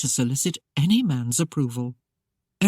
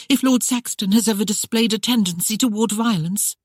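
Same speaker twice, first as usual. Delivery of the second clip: anxious rush; 6 semitones higher than usual. Acted. Bride asking the housekeeper about her husband, anxious and rushed. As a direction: rush it out worried. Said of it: "in an anxious rush".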